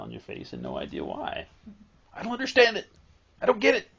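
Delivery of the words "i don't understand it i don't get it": high, loud baffled voice; a joyfully puzzled person, much higher and louder; mock bafflement. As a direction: play the giddy person who has no idea why. Mimicking a baffled happy person.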